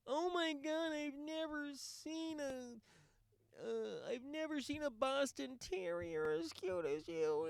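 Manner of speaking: high, slow cooing over a Boston Terrier; mock gushing. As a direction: parody a fake dog lover's baby talk. Mocking fake dog lover.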